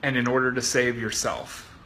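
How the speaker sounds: soft, low mock-soothing coach; ever so gently. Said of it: "He said ever so gently".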